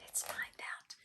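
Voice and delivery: breathy secret whisper; conspiratorial whisper to the listener. Acted. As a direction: lean in and whisper it. Whispered aside to child.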